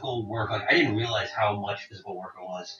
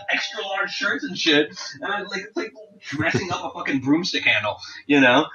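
ordinary talk

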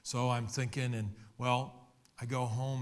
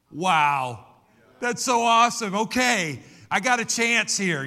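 loud, high, thrilled burst of hope; excited; 9 semitones higher, 11 dB louder than usual. Acted. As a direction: let it burst out, overjoyed at a second chance. Peter imagining his second chance.

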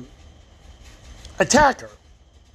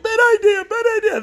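mock panic; faster than usual. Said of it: "they were like".

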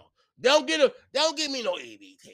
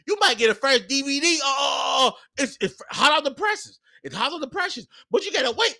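giddy excitement; 10 semitones higher than usual. Real, not acted. Comedian recalling a fresh bootleg DVD, shouting with giddy delight.

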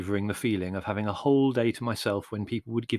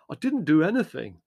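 raised, indignant denial; 6 semitones higher, 4 dB louder than usual. A narrator's reading. Larry denying he frightened the donkey, indignant. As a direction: protest innocence, stung. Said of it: "said Larry indignantly".

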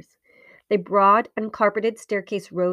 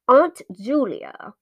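slow, loud, stern address; commanding, deliberate and loud; loud and severe; 3 semitones higher, 6 dB louder, much slower than usual. A narrator's reading. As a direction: call her name severely, drawn out. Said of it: "he said loudly and severely".

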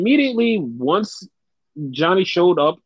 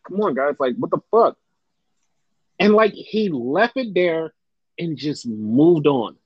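normal talking voice

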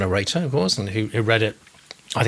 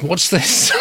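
mock bewilderment; 6 semitones higher than usual. Acts out a viewer's baffled comment.

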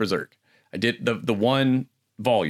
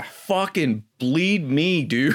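mock despair; 7 semitones higher, much slower than usual. Real, not acted. Joking despair at long show.

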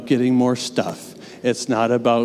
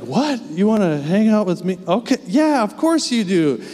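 higher, amazed and touched; playfully stunned that she wants him; delighted disbelief; 7 semitones higher than usual. Retold or imitated. Father surprised by his toddler's hug, higher delighted disbelief. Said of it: "I'm like".

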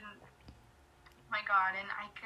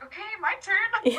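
high, loud, drawn-out eager squeal; excited; 11 semitones higher, 7 dB louder than usual. Retold or imitated. Fan announcing her turn to meet him, excited.

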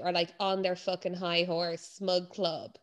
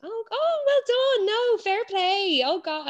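high, over-the-top congratulations in a put-on voice; fake-impressed cooing at a tiny run; mocking a smug runner heaping fake praise; mock gushing praise; 12 semitones higher, slower than usual. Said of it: "they're like".

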